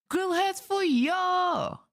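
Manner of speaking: slow, high, drawn-out cheer; excited shout-out. Real, not acted. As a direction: sing it out to chat.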